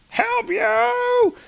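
very high, shrill-edged disbelief; incredulous outburst. Retold or imitated. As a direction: throw the words back, outraged and amazed. Gore repeats, rising to outrage.

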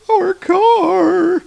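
high squeaky cry of dismay; dismayed tourists. Retold or imitated. Tourists see their car explode, high squeaky dismay. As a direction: yelp it like a shocked tourist. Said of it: "And they're like".

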